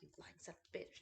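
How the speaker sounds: hissed under the breath; voiceless aside at the husband; whispered mock insult. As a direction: mutter it as a private jab. Retorting to husband while angry.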